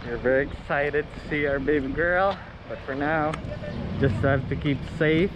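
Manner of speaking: muffled speech through a mask; through a face mask. Real, not acted. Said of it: "I hope you can hear me through my mask".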